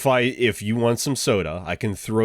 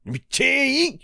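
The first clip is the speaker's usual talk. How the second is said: mock big voice. Mock magic words voice.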